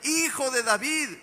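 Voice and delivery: grito alto y fuerte, como un ciego que clama; grito demostrado. Shown as a demonstration. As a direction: muestra a la congregación cómo gritaba. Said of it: "¿Cómo le gritaba, hermanos?". (el predicador demuestra el grito de Bartimeo)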